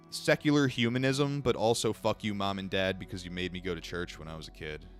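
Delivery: usual speaking voice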